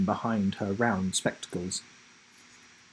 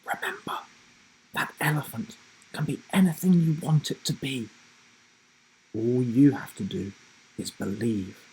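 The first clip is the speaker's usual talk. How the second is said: grandma's whisper; 3 semitones higher than usual. Acted. Grandma whispers encouragement in his ear. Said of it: "and whispered in his ear".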